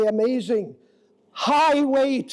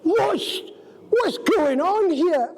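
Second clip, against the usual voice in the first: alarmed surprise; 6 semitones higher than usual. Retold or imitated. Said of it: "And I said".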